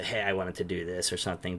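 ordinary delivery

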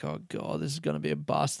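soft, sinking groan of disappointment; quietly resigned; deflated mutter. Retold or imitated. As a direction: mutter it to yourself, defeated. Disappointed inner voice.

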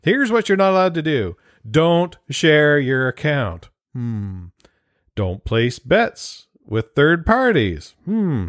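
slow, deliberate rule reading with pondering hums. Retold or imitated. Reading the league's betting rules aloud, slow and mulling. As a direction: read each rule slowly, mulling it. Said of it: "here's what the league sent out".

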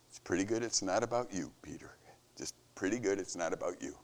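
a hushed murmur of Jesus, barely aloud; Jesus under his breath. Retold or imitated. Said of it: "Jesus under his breath".

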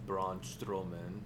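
slow, low mock Russian accent; a hammed-up Russian accent. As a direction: drawl the name in a put-on Russian accent. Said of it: "Why, why did we go to a Russian accent?".

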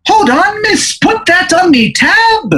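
high-pitched, stretched-out bad Irish brogue; terrible Irish accent. A stranger calling from behind at the bar, terrible Irish accent. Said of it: "It's the worst Irish accent".

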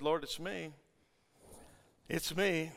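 very soft, timid admission; hushed confession. Acted. Woman confessing to the Lord, very softly. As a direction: confess it almost under the breath.

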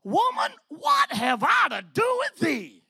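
high, loud, hammed-up shout of Woman; mock harsh rebuke. Jesus mock-rebuking his mother, high hammed-up shout. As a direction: bark it like a husband snapping at his wife, laughing. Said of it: "He says this".

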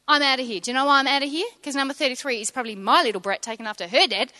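ordinary talk, nothing marked

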